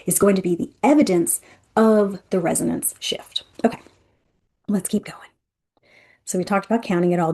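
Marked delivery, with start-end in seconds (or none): 2.3-3.4 whisper. Real, not acted. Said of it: "dropped to a whisper". 3.6-5.3 whisper. Real, not acted. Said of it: "dropped to a whisper".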